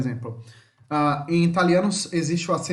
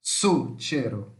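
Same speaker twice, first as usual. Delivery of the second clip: slow, lower "Succeiro", first syllable strong; stress on SU; 6 semitones lower, much slower than usual. Shown as a demonstration. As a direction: demonstrate "Succeiro" with a long opening SU. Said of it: "Mais forte é o su". ("Succeiro" devagar, com o su mais forte)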